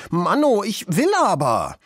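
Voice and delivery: put-on high, sulky little-girl protest; pouting child's cry. A narrator's reading. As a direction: whine and insist with a pout. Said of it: "zog eine Schnute und rief".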